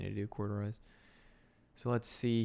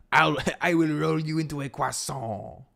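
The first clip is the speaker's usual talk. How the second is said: loud, higher French chef threat; French accent; 6 semitones higher, 9 dB louder than usual. Acted. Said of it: "my French accent is unrivaled".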